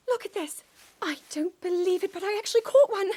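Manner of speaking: bubbling over with a discovery; thrilled. Acted. Princess to her knight, showing off her catch: rising excitement.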